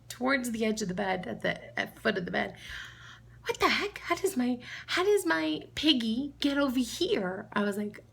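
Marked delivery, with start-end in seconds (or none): 3.5-7.5 high-pitched imitation of a puzzled little girl; Lila. Niece finding piggy at the bed's foot, baffled. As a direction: play a small child baffled by her misplaced toy. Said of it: "and she's like".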